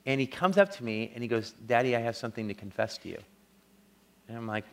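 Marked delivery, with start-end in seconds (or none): 1.7-3.2 put-on soft, low, hurried retelling of a child confessing; retold child's confession. Son owning up to his father, quiet retold confession. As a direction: quote the boy gently, low and quick.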